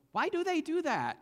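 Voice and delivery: put-on high, small child's voice asking; innocent, wondering child's question; little voice. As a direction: ask it like a curious five-year-old. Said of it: "this little voice in the back seat go".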